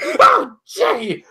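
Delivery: very high-pitched startled yelp; mock startled. Podcaster acting out Rarity turning around, mock startled squeal. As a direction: imitate a startled character, leaping high in pitch.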